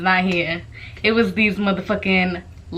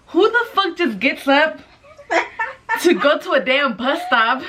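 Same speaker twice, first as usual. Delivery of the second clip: laughing; 7 semitones higher than usual. Real, not acted. Laughing at absurdity.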